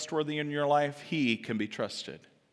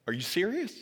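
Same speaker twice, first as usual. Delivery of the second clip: higher, questioning, rising at the end; mild disbelief; 5 semitones higher than usual. Acted. Mephibosheth astonished before the king.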